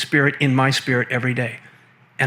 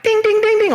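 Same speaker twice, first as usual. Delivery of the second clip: high, loud mock alarm bell dings; 4 dB louder than usual. Mocking his inner alarm at the guru remark, high ringing dings. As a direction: ring it out like a warning bell.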